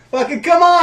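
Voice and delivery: very high, very loud yell; exasperated shout. Real, not acted. Urging a tongue-tied friend on, exasperated shout.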